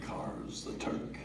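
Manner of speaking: hushed, conspiratorial whisper; breathy whisper with a smirk in it; sly whisper. A narrator's reading. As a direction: whisper it like a secret joke. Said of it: "Mom whispered with a smirk".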